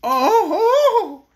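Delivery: high, loud gasp of delight; a breathless burst of joy; delighted exclaim. Acted. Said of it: "she exclaimed, drawing a deep breath of delight".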